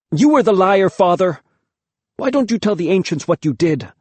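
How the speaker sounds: loud, high, bitter accusing cry; angry accusation. A narrator's reading. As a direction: hurl it at him bitterly. Boy accuses father.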